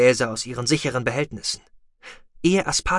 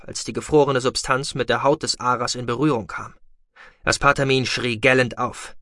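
ordinary delivery, nothing marked

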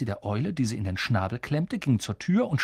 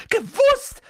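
shrill shriek, much higher and louder; 7 dB louder than usual. A narrator's reading. Aunt Petunia suddenly shrieks at Harry. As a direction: explode out of nowhere. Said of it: "schrie Tante Petunia plötzlich auf".

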